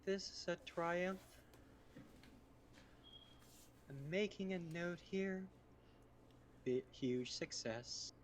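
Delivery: slow robotic AI voice; lightly voiced deadpan computer; male GLaDOS. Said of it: "try to do a glados impression".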